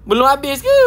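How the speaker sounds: high, loud incredulous question; high-pitched shocked query; startled disbelief. Real, not acted. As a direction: blurt it out in rising disbelief. Startled that the game goes on.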